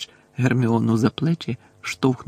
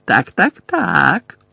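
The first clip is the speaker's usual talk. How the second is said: loud, gloating repetition, rising in pitch; smug triumph; triumphant; 5 semitones higher, 6 dB louder, much slower than usual. A narrator's reading. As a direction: savour the victory out loud. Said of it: "переможно проказала Амбридж".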